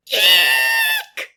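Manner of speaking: loud startled squeal; a big scared lion squeaks it out; frightened eek. Acted. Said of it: "and let out an".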